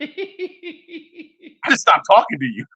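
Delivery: slow, laughing it out. Real, not acted. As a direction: say it through a laugh, slowly. Laughing denial to co-host.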